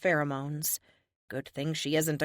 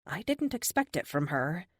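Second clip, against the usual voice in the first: usual speaking voice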